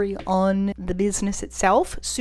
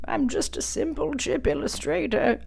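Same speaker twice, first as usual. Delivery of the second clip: put-on raised, strained voice of a tired old designer; old man voice; 5 semitones higher than usual. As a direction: play a weary old-timer set in his ways. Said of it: "using my old man voice".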